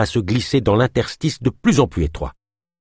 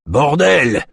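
high, forceful angry exclamation; loud, exasperated swearing; roared curse; 7 semitones higher, 4 dB louder than usual. A narrator's reading. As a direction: roar it out in frustration. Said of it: "rugit Kolb".

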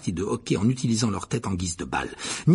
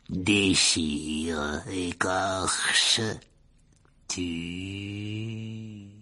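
slow, low, spaced-out menace; cold, deadly voice; 3 semitones lower, much slower than usual. A narrator's reading. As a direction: chill murderous creature voice. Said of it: "la même voix froide et mortelle".

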